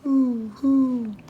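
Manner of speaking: high hooting and crowing; playful animal sounds. Pretend. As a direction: make the animal noises for a toddler.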